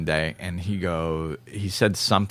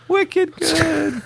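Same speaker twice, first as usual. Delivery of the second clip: put-on much higher squeaky "Wicked good" with a Boston accent; New England cheer. Host repeating the New England fake applause, squealed. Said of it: "the New England fake applause".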